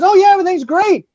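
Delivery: high, quick imitation of breezy reassurance; mock cheerfulness. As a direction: act overly innocent and upbeat. Retold girl insisting all is fine.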